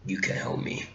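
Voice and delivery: very soft, near-whispered acting; hushed reply. Fan voicing Kylo Ren answering his father, hushed. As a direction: answer under the breath.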